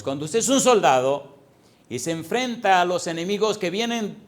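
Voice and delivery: loud, high, driving sermon voice; fiery preaching. Acted. Preacher urging the congregation to fight, full force. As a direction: preach it hard, building the battle image.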